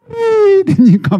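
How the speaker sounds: high, slow wail of disbelief; stunned cry. Acted. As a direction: cry out that it can't be true. Brothers' disbelief, crying.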